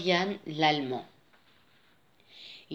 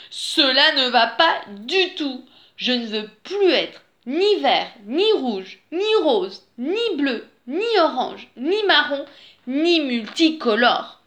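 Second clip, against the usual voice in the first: higher-pitched, exasperated listing; at wits' end; 6 semitones higher, 4 dB louder than usual. A narrator's reading. Wolf alone at his mirror, exasperated. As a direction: reel off each refusal, fed up. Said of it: "à bout de nerfs".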